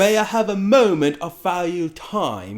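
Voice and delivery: hammed-up proper request in broken English; Bella speaking properly. Bella asks for time in broken English, imitated high and loud. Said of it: "already Bella is speaking properly".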